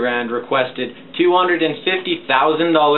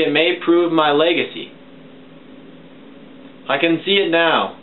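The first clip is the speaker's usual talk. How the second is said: usual speaking voice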